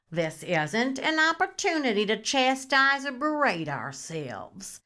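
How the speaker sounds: ordinary talk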